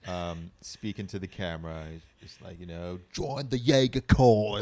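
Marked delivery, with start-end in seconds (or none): 3.1-4.6 slow, loud mock British hero line; British accent. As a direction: play a stiff British hero, slowly and loudly. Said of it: "He's British".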